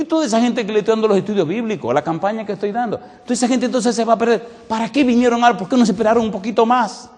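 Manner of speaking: high, fast, pleading complaint; mock-distressed plea with a laugh; half-laughing protest. Pleading with the angel.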